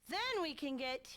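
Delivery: normal talking voice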